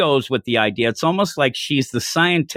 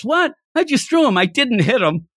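indignant protest; 9 semitones higher than usual. Retold or imitated. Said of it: "And he goes".

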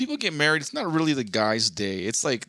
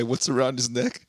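laughing. Real, not acted. Reading comment, laughing.

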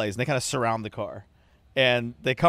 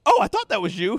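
higher, louder imitation of the valet's recognition; mock surprised valet; 9 semitones higher, 6 dB louder than usual. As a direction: play the valet suddenly recognizing him. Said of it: "he goes".

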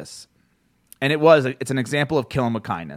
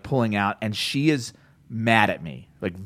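normal talking voice

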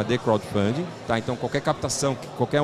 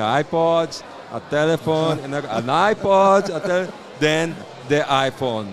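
excited keynote build; 3 semitones higher, slower than usual. Retold or imitated. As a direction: build the hype like a product launch. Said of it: "Aí ele ficou repetindo". (empolgação crescente de apresentação)

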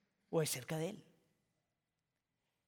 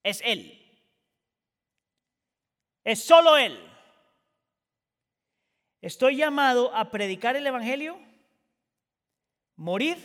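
missionary's vow cried out louder; a louder, higher ringing declaration; passionate quote; 5 semitones higher, 11 dB louder than usual. Retold or imitated. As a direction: proclaim it with fire. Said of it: "dijo él".

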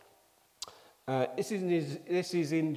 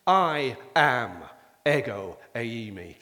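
louder, firm and slow on ego eimi; emphatic I am; 5 semitones lower, 4 dB louder, slower than usual. Shown as a demonstration. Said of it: "It means I am being emphatic".

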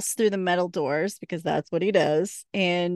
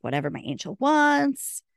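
Bobo's mock devotion; 7 semitones higher than usual. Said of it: "And he's like".

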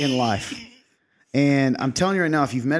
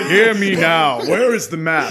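higher, louder regal proclamation; kingly declaration; 4 semitones higher, 7 dB louder, slower than usual. Retold or imitated. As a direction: announce it like a king. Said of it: "He declares everything".

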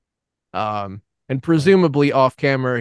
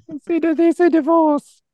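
high squeaky mock Schwarzenegger, much higher; a hammed-up Arnold impression, pitched way up; 5 dB louder than usual. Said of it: "I wanted it in a Schwarzenegger voice".

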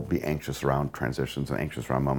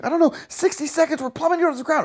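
terrified; 3 dB louder, faster than usual. Acted. Acted client voice in dialogue.

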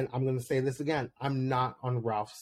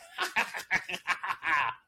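a hammed-up witchy cackle, high and pitched up; pretend cackle; 5 semitones higher than usual. Cackling at the ladies who called her a hag, a mock cackle. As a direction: cackle like a hag to scare them. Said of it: "a cackle to remember".

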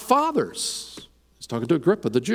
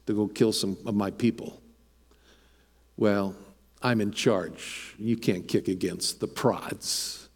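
Jesus speaking to Saul; 6 semitones lower, 4 dB quieter than usual. Acted.

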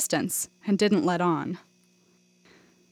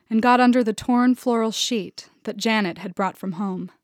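ordinary delivery

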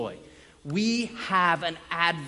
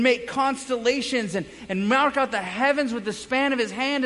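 raised, fast, impassioned sermon; preaching; 7 semitones higher, 6 dB louder than usual. Acted. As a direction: preach it out to the room with full force. Preaching at full force.

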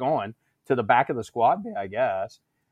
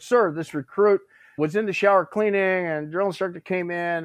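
higher-pitched recruit addressing a superior; lightly voiced formal recruit; retold recruit report; 7 semitones higher than usual. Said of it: "doing the recruit thing".

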